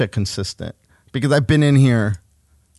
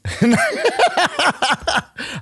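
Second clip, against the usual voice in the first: burst of laughter. Real, not acted. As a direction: crack up at your own story.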